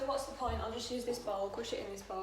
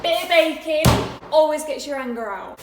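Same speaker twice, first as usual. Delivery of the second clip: loud; 4 semitones higher, 13 dB louder than usual. Real, not acted. Jokey loud remark while baking.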